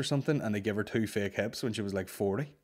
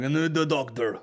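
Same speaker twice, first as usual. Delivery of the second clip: put-on Eastern European accent; 5 semitones higher, 5 dB louder than usual. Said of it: "he's got a real strong fucking".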